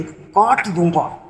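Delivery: slow, drawn-out mock threat of the neighbour; mock menacing threat. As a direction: drag out "काट दूंगा" like a menacing thug. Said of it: "मैं डर गया भाई". (धीमी, खिंची हुई नकली धमकी)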